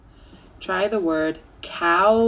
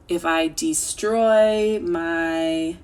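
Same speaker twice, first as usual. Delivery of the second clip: slow and emphatic on destroy; slow teacher delivery; slow, deliberate; 3 dB louder, much slower than usual. Shown as a demonstration. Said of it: "teaching the word destroy".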